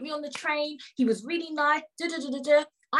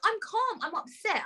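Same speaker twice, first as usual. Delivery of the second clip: high voice easing down as it goes; upset protest; 8 semitones higher than usual. Retold or imitated. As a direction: retell an upset insistence, starting high. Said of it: "when I was crying".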